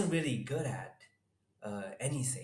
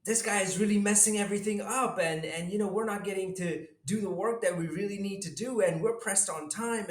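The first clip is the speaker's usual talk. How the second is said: high, fast, frantic elf complaint; panicked elves; 9 semitones higher, 4 dB louder, faster than usual. Retold or imitated. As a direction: rush it out like a stressed elf. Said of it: "they would come running to me like".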